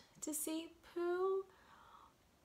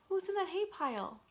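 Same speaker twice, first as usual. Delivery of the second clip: ordinary delivery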